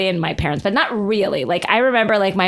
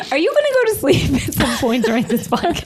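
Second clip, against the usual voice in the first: laughing; 6 semitones higher than usual. Real, not acted. Teasing while laughing.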